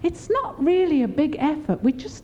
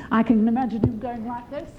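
chuckling; 4 semitones lower, faster than usual. Real, not acted. Laughing aside mid-sermon.

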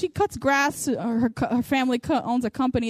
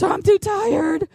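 slow, high mock complaint; mock whining; 6 semitones higher, slower than usual. Woman making an excuse not to be happy, mock whining. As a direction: drag it out like a feeble excuse. Mimics her own tiredness excuse.

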